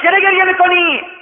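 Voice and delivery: acted husband's startled outcry; indignant question. Husband challenges weeping wife.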